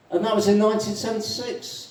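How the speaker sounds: high-pitched, chatty addition; animated Irishman. Acted. Irishman adding the year for a fellow drinker, animated. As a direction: pitch it up as a new revelation.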